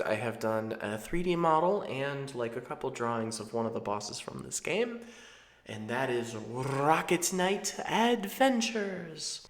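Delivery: usual speaking voice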